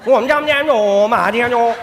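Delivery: quick singsong nonsense syllables; mock Chinese gibberish. Preacher mimics a foreign tongue for the congregation, playful mock gibberish. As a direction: toss off nonsense sounds like an unknown tongue. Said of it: "जिबरिश ही सुनाई देती है".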